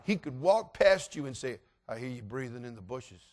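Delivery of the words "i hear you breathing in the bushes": low, soft knowing murmur; sly seeker. Retold or imitated. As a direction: tease the hiders quietly. Seeker eerily teases hidden players.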